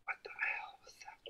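hushed, frightened whisper; scared whisper. Real, not acted. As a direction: whisper it like something's in the room. Spooked by noise, whispering.

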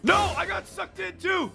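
very high panicked cry; dismayed wail. Real, not acted. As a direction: wail as you get pulled in. Sucked in while rescuing.

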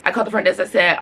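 normal talking voice

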